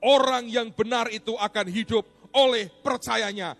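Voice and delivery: loud, high, forceful sermon delivery; fiery preaching. Acted. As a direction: preach it with full force to the congregation. Preacher at full force opening.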